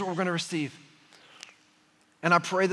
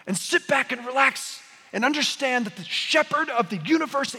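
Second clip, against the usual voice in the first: preaching at full force; 7 semitones higher than usual. Real, not acted. Preacher pleading with his congregation, impassioned. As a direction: climb and press it home to the room.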